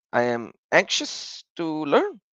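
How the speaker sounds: normal talking voice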